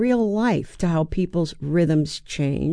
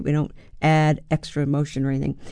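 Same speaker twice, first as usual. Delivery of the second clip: normal talking voice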